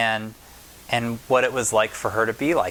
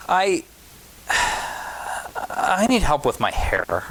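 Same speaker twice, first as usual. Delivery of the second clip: sighing plea; 4 semitones higher, much slower than usual. Acted. Child asks for help, sighing.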